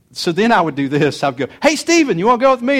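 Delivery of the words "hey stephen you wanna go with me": loud, high call to a son; re-enacted holler, pitched way up. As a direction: call out an eager invitation. Re-enacting calling his son.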